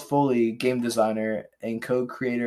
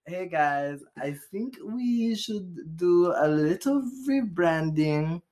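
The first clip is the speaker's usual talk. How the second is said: lightly voiced Hitler; 4 semitones higher, much slower than usual. Voicing Hitler pitching a rebrand.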